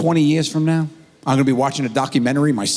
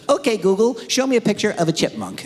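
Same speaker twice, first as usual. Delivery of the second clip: put-on higher-pitched little boy voice; imitated four-year-old; 6 semitones higher than usual. Young son asking Google for animal pictures, higher child voice. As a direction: ask like a small child addressing a gadget. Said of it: "My son is four years old".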